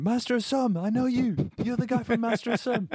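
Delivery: very high, loud mock fan shout. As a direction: call out excitedly while cycling past. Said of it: "Darren did cycle past shouting".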